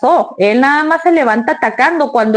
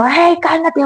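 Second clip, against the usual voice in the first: impatient scolding; 5 semitones higher, slower than usual. Retold or imitated. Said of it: "si le estás diciendo".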